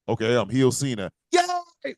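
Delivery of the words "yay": high, thin fan cheer; a squeaky little mock yay.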